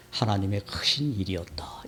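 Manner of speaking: 숨소리로 "크신"을 살짝 강조하며 속삭이기; 비밀을 털어놓듯 속삭여; whisper. Acted. Quoting scripture in sermon. (속삭이듯 전하는 말씀)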